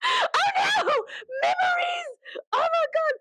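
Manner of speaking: very high, hammed-up wail; mock horror. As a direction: wail as if haunted by memories. Plays horror at returning memory.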